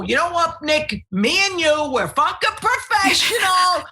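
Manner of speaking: high, hammed-up rallying to a co-host; mock conspiratorial rant. Comic telling co-host Nick they are the pros, high mock shout. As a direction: pitch it way up, egging him on. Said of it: "And I go".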